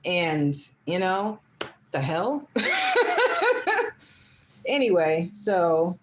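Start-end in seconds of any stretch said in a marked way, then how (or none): 2.5-3.9 high burst of real laughter. Real, not acted. Laughing at her family pushing the ex, amused. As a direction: let the laugh break out.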